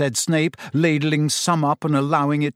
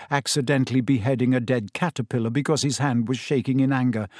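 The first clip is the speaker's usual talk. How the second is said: usual speaking voice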